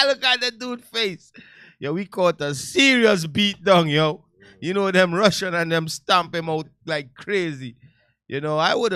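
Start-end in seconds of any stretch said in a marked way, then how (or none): none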